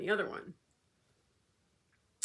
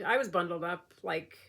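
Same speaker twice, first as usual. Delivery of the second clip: ordinary talk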